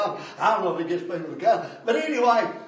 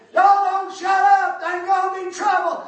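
hollered threat; 10 semitones higher, 8 dB louder than usual. Retold or imitated. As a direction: holler it down like an angry neighbour. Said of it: "he hollered out there one day".

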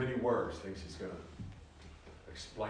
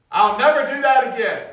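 much higher and louder, a hammed-up vow; loud mock promise; 19 dB louder than usual. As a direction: belt out the father's empty promise, much higher. Quoting father's promise.

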